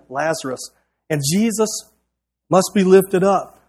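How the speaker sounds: ordinary talk, nothing marked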